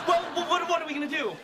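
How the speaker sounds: stammering in a very high squeal; high-pitched comic panic; panicked. Acted. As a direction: flounder helplessly in falsetto. Acted panic at pregnancy news.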